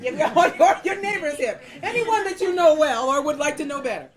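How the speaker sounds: high, projected over the laughter; loud joking banter; loud playful aside. Real, not acted. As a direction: call it out teasingly to the crowd.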